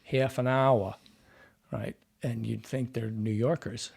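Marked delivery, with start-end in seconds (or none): 0.0-0.9 New Orleans accent that passes for Brooklyn; demonstrating a Brooklyn-like New Orleans accent. Shown as a demonstration. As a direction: say it like a New Orleanian, easily mistaken for a New Yorker. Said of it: "there are sounds like they'll say".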